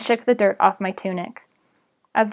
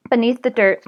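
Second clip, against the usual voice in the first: usual speaking voice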